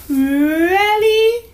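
high, loud, drawn-out disbelief; child's squeal of surprise; amazed little girl. Acted. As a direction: stretch it upward in astonishment. Child amazed at homemade pancakes.

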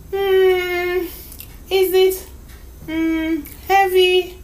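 put-on high, hesitant child's voice; hum, then venture a guess; small girl puzzling it out; little girl guessing. Child guessing at her gift.

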